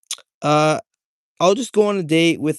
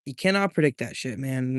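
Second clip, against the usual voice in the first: ordinary talk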